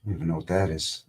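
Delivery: ordinary talk, nothing marked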